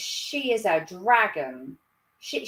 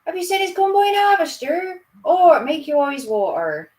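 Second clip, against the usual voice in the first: put-on Norfolk farm boy; 5 semitones higher, 6 dB louder than usual. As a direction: play a farm lad with a broad Norfolk accent. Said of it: "the boys from the farm would be like".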